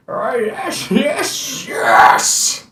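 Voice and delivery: high, rising shouts of yes; excited old man cheer. Acted.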